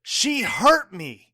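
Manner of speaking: a slow, pained confession; anguished. Acted. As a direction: wring out every word. Confronted about his wife.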